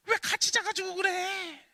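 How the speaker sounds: high, loud, slow mock scolding mom; exasperated mother. Mother scolding her crying daughter, high and slow. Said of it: "엄마가 딱 듣더니".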